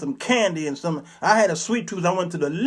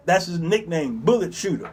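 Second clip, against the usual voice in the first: ordinary delivery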